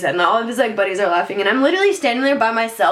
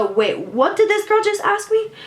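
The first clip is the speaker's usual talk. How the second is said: high-pitched mock disbelief; imitating incredulous laughter; 7 semitones higher than usual. Rapper reacting to her question in his face, mock incredulous. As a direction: mimic him scoffing at a baffling question. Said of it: "laughed in my face as a".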